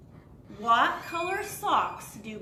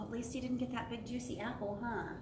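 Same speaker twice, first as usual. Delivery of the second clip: ordinary delivery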